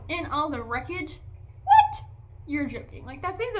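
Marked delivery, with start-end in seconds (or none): 1.6-2.0 very high, loud disbelief; hammed-up shriek of disbelief; incredulous squawk. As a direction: yelp it in shock. Mock disbelief at the plot.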